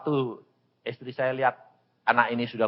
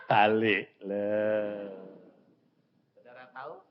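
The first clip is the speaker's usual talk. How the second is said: usual speaking voice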